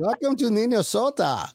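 high, swooping Minnesota welcome; Minnesota accent. Pretend. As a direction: ham up the Minnesota-Canadian lilt. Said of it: "That was a good Canadian voice".

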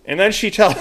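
ordinary talk, nothing marked

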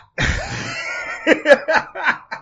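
real high, squealing laugh; high-pitched laughter. Real, not acted. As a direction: crack up helplessly at the teasing. Cracks up at co-host's teasing.

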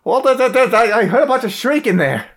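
high, loud panicky voice; panicked. Acted. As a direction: stammer in rising panic. Scared character after shrieks.

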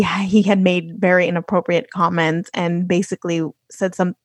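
normal talking voice

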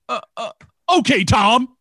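high, hesitant start bursting into a loud yell; sudden loud, high-pitched mock bark; mock wrestler outburst. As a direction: imitate an intimidating wrestler blurting it out. Acting out Ron's intimidating reply.

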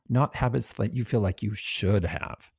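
normal talking voice

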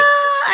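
sudden high yelp of disbelief; shocked shriek. Real, not acted. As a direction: shriek in mock outrage. Shocked at size two.

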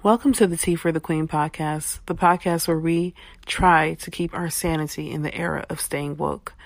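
loud, projected host welcome; big, emphatic podcast opener; podcast show intro. Acted. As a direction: announce the show with full voice.

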